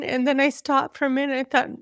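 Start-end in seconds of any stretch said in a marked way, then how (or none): none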